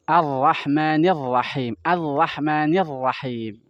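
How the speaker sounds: Ar-Rahmanir pelan, mulut dibuka lebar; ra dengan mulut terbuka. Shown as a demonstration. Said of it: "Jadi mulutnya dibuka". (Ar-Rahmanir Rahim, mulut terbuka)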